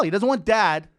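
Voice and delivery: usual speaking voice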